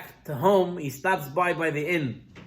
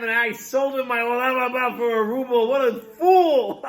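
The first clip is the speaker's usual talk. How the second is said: gloating laugh; 7 semitones higher, 4 dB louder than usual. Acted.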